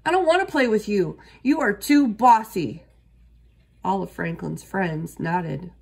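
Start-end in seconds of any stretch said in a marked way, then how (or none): 0.0-2.8 loud, fast, high complaint; shouting. A narrator's reading. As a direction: keep shouting at him, fast. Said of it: "shouted Bear".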